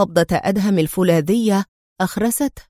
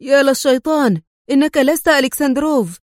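higher, startled cry; lightly voiced shock at the reveal; stunned exclamation; 5 semitones higher, 3 dB louder than usual. A narrator's reading. Tino realizing the man is not Alexandrov, mild stunned cry. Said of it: "تراجع تينو في ذهول وهو يهتف".

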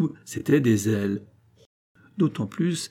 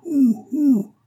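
high, loud drawn-out "ouh, ouh" call; high-pitched pursuing holler; distant cry; 6 dB louder than usual. A narrator's reading. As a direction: cry out the last syllable of a name. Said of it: "prononcée par le cavalier, un".

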